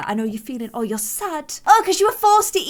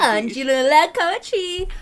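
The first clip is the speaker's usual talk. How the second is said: mock saleswoman; 4 semitones higher than usual. Imitating saleswoman saying name.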